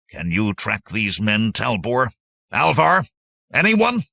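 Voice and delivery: loud questions climbing in pitch; calling on men. A narrator's reading. Hordo calls on the band.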